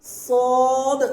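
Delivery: صاد with a heavy emphatic s; heavy saad. Shown as a demonstration. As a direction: give صاد a weighty, thick s. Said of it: "بھاری آواز میں نکالیے".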